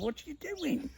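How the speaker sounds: high, baffled inner question; thought it in shock. Retold or imitated. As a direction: ask yourself in disbelief. Thinking it on stage, shocked.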